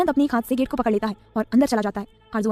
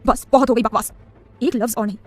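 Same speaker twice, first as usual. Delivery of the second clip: loud angry roar on बस, much louder; thundering; 6 dB louder than usual. A narrator's reading. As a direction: bellow to cut her off. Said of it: "उस पर गरजते हुए कहता है".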